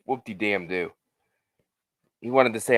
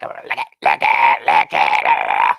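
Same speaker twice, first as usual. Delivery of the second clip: low, then rising, shouted creepy rasp; Billy's creepy voice; 7 semitones lower, 6 dB louder, much slower than usual. Retold or imitated. Fan imitating Billy's voice from the movie with a shout. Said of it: "Billy's lovely, lovely voice".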